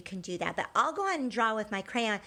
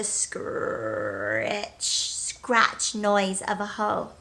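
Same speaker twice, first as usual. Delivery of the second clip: slow suspense; much slower than usual. Acted. Scary sound narrated slowly.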